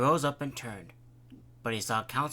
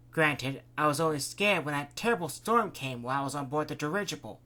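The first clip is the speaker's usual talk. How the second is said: higher, lively woman telling of her trip; lightly voiced captain's wife; 4 semitones higher, 6 dB louder than usual. Captain's wife telling the council about her trip, lightly voiced. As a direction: chat breezily at dinner.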